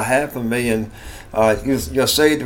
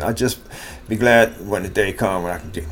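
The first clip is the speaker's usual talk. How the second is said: ordinary delivery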